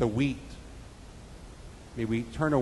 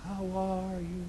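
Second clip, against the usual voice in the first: soft, drawn-out echo coming back; distant echo; 5 semitones higher, 9 dB quieter, slower than usual. Acted. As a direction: answer like a faraway echo. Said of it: "Shortly after he heard".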